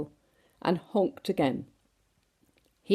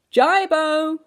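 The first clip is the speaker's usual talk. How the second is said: sailing call; 10 semitones higher, 6 dB louder than usual. A narrator's reading. Sailing calls.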